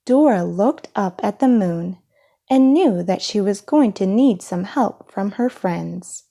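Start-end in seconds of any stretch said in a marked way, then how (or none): none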